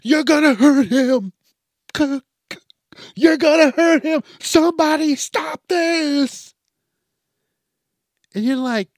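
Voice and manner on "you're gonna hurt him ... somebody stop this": high, loud mock yelling; panicked bystander yell. Zoo visitor yelling at fighting animals to stop, mock shouting. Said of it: "they're the person that's yelling".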